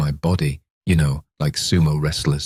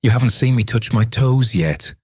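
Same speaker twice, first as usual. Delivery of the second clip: mock yell; 7 semitones higher than usual. A narrator's reading. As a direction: shout it after him, playful. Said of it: "she yelled after me".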